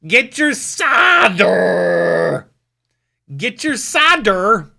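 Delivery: loud, high, long-drawn comic yell; mock vendor's holler. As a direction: holler it out like hawking wares, stretching the vowels. Hawking the word as a joke.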